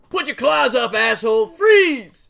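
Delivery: loud cop-style Freeze; shouted command. Acted. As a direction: yell it at the creature. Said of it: "I'm basically saying to the claw creature".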